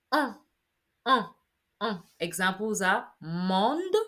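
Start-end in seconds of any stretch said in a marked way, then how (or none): 0.1-2.0 "uh" as short nasal French on; nasal vowel. Shown as a demonstration. Teacher demonstrating ON to learners, "uh" as rounded nasal on. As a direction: repeat "uh" rounded and nasal, separately. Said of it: "are nasal vowel sounds".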